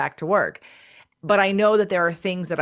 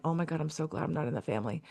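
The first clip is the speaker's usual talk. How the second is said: much softer, quick relieved murmur; relieved aside; 4 semitones lower, 10 dB quieter, faster than usual. Retold or imitated. Leaving the aunt, murmuring relief, softly. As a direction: breathe it out under the breath. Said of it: "I left that going".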